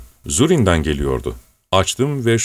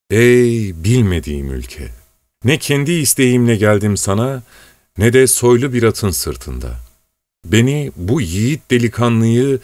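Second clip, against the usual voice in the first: recited folk song lyric; 2 semitones higher than usual. Acted. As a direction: intone it like a sad old ballad. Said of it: "Eski bir türkü".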